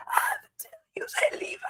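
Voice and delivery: strained whispery rasp, no voice; mimicking a voiceless character voice; damaged vocal cords. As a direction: squeeze it out through ruined vocal cords. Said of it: "talking like this the whole time".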